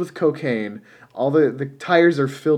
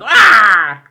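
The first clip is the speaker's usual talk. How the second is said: loud imitated comic scream; Tom Hanks yell; 6 semitones higher, 11 dB louder than usual. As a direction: belt out his high yell. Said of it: "it's always his voice where it's like".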